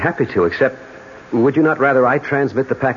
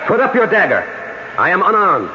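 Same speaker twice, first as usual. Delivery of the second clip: high, raised command; urgent protest; 7 semitones higher than usual. Acted. Unarmed lover faces dagger, urgent protest. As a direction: call on him to stop.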